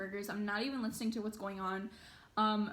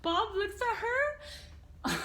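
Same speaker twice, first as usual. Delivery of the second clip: high voice breaking into laughter; can barely get the words out for laughing; giggling at how terrified Bob looked; laughing through words; 10 semitones higher, slower than usual. Real, not acted. Cracking up at scared friend.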